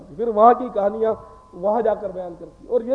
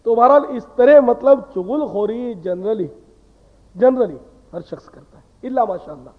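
ordinary talk, nothing marked

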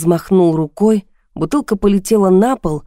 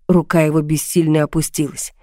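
ordinary delivery

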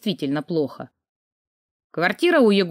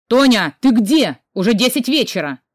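loud, higher, demanding shout; shouting into phone; 4 semitones higher, 6 dB louder than usual. A narrator's reading. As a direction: call out sharply down the line. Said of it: "Игорь кричал в трубку". (громко, с криком в трубку)

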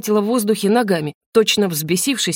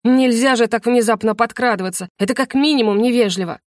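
usual speaking voice

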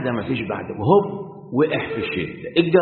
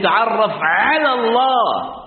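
slow wrong wording; 7 semitones higher, 3 dB louder, much slower than usual. Shown as a demonstration. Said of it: "ما قالش".

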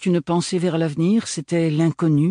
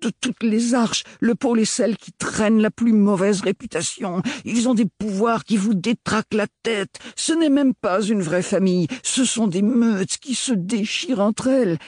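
oncle emporté, beaucoup plus haut, véhément; un vieux qui s'emporte contre le pôle; vieil oncle en colère; 8 semitones higher than usual. A narrator's reading. (grand-oncle furieux, voix haute et pressante)

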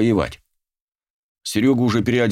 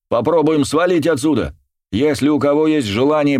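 higher shouted appeal to the crowd; shouting; 7 semitones higher than usual. A narrator's reading. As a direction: call out to the whole room. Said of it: "я прокричал".

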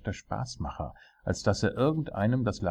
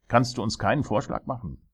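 raised, pressing royal question; impatient; 5 semitones higher, 4 dB louder, faster than usual. A narrator's reading. King demanding a suggestion from his jester, impatient. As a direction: press him for an answer. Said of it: "sagte der König ungeduldig".

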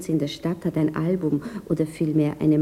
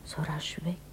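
whispered, much softer, hurt questions to herself; hushed inner thoughts; 3 semitones lower, 12 dB quieter, slower than usual. Acted. Woman alone, wondering why he hurried away, whispered. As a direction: breathe it as a private thought.

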